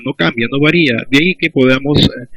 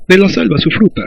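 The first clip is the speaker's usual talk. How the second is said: Peruvian jungle accent; 6 semitones higher than usual. Shown as a demonstration. Teacher demonstrates jungle word order to class, Peruvian jungle accent. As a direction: say it like a speaker from the Peruvian selva. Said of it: "en la selva suelen usar u ordenar las palabras".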